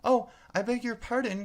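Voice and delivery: high, loud and hurried sorry; hasty apologetic cry. A narrator's reading. Alice hurriedly apologising to the frightened mouse, high and anxious. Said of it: "cried Alice hastily".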